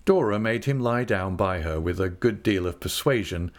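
ordinary delivery, nothing marked

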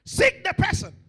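high, very loud command; commanding shout. Real, not acted. Preacher commanding the congregation, shouting. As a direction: punch it out to the back of the hall. Preacher announcing point at full force.

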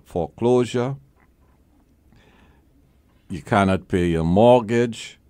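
ordinary delivery

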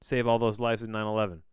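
normal talking voice

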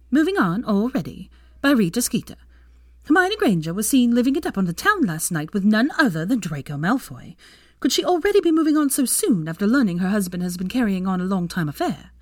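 punchy gossip-page voice; gossip column read-out. A narrator's reading. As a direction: read it out like a scandalous headline.

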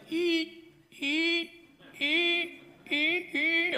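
high thin squeaking eek; squeaky rope creak. Retold or imitated. Preacher mimicking the creaking rope, high squeaks. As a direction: squeak like a rope lowering a man. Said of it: "I was like".

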